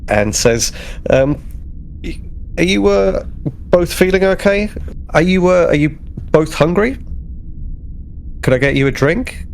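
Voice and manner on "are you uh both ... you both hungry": normal talking voice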